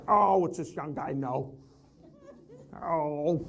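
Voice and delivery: put-on slow, scoffing old-timer voice; imagined veteran salesmen scoffing slowly at the young guy; mock old skeptic. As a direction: sneer like a jaded veteran.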